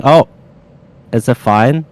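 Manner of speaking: ordinary delivery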